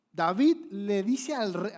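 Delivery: slow, loud and high; slow building. Acted. Preacher building suspense before David speaks, slow and loud. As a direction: stretch every word to build suspense.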